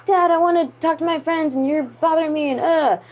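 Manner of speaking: high-pitched, exasperated daughter whine; imitated argumentative younger self; bickering with dad. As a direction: complain to dad about being bothered. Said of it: "we got into, like, a, a little fight".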